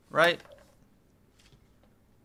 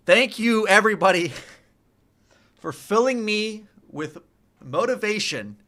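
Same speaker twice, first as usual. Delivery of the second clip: excited; 6 semitones higher, 5 dB louder than usual. Real, not acted. Thanking chat happily.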